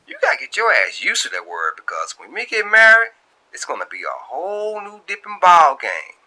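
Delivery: high, loud, playful warning; Shantae voice. Acted. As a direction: lay down the law about marriage. Shantae talking marriage.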